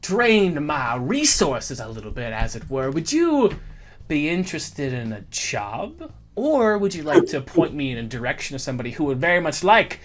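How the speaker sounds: a hint of a Southern colonel accent; Southern gentleman accent. GM voicing Jedediah to the party in a Southern accent. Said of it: "And he just goes".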